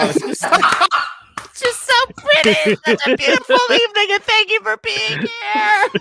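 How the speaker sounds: very high, drawn-out weepy squeal; mock tearful gushing. Host thanking everyone for being here, mock tearful high gushing. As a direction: gush slowly in a squeaky, laughing near-cry.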